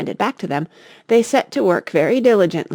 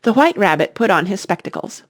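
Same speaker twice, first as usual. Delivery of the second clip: usual speaking voice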